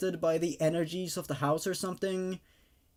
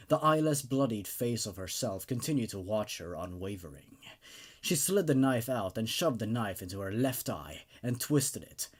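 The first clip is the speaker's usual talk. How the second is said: measured, low storytelling read; horror story narration; 6 semitones lower than usual. A narrator's reading. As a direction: read it like a creepy tale at night. Reads a horror story aloud.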